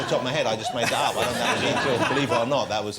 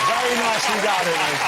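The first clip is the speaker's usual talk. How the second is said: normal talking voice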